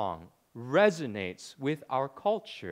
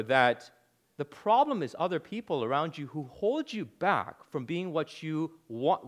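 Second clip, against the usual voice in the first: ordinary delivery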